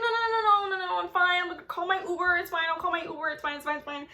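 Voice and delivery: high, panicky no no no; nervously turning down the date's mom, high and flustered; flustered refusal. Retold or imitated. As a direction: wave off the ride in a nervous rush. She flusters declining the mom's ride.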